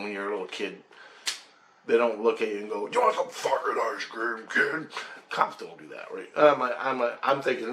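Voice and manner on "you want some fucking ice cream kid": mock tough-guy offer; a hint of gruff intimidation; not-nice cop. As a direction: lightly imitate a menacing man. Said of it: "he's not nice like a cop".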